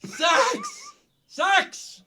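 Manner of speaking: high-pitched squeal of the word; squealed exclamation. Real, not acted. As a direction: blurt it out in a shriek. Blurting the taboo word out.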